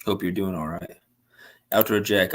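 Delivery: ordinary talk, nothing marked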